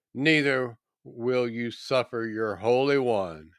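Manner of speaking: ordinary talk, nothing marked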